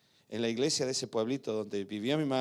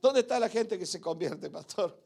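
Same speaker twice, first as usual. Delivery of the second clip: retold shout; 8 semitones higher than usual. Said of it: "Yo me acuerdo que le grité".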